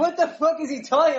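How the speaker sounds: high, laughing what-the-fuck; incredulous through giggles; laughing disbelief. Retold or imitated. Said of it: "I was like".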